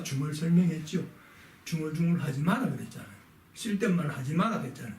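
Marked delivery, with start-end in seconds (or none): 1.6-4.9 quick recap. Retold or imitated. Teacher rattling off earlier warnings.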